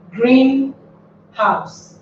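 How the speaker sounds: first-part stress on green, slowly split from house. Shown as a demonstration. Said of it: "must be stressed at the first syllable".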